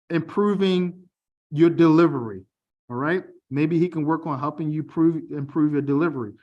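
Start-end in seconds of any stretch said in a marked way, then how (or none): none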